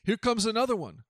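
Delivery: mild narrated shout, pitched high. A narrator's reading. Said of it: "He shouted down".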